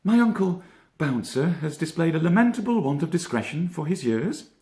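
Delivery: high, measured rabbit musing; a young rabbit weighing things up gravely; reflective Peter. A narrator's reading. Peter musing on uncle Bouncer, high reflective voice. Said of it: "said Peter, reflectively".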